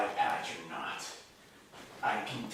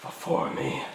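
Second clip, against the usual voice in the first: dark lord; 5 semitones higher than usual. Acted. Villain commands kneeling.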